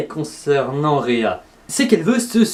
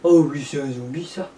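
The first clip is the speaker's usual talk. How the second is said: zombie voice; 2 semitones lower than usual. Pretend. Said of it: "Tu es un zombie, toi?".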